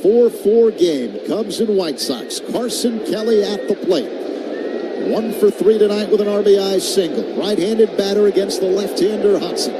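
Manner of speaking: ordinary talk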